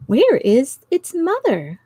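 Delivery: a hint of a small child, slow; a little boy asking, lightly voiced. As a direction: ask it slowly, like a little boy. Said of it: "said little Tommy".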